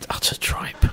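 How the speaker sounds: fast scornful whisper. Acted. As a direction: hiss it under your breath. Dismissing the band's other songs.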